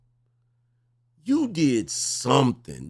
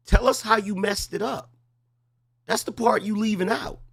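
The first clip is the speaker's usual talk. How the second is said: pressing demand; 7 semitones higher than usual. Real, not acted. Host pressing caller to confess.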